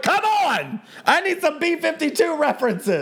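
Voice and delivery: high, exasperated mock cry; exasperated mock plea. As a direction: whine it up high like a frustrated fan. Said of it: "I'm like".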